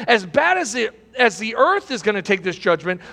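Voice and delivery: ordinary talk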